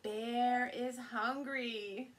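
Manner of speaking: plain reading voice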